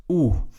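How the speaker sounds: oe pronounced as one long "oo"; Dutch oe diphthong. Shown as a demonstration. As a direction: say oe as a single rounded "oo" vowel. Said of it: "we will pronounce as".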